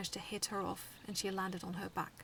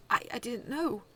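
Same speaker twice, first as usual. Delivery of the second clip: flustered exclamation; 4 semitones higher, 9 dB louder than usual. A narrator's reading. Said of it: "he exclaimed quickly".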